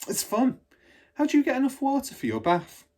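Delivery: put-on a curious child asking her teacher; Matilda's voice.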